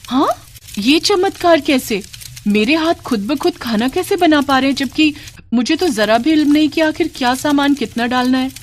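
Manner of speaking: put-on high-pitched, bewildered young bride; astonished. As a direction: marvel aloud at a miracle, pitched well up. Hands cook by themselves, miracle. (ऊँची आवाज़ में हैरानी)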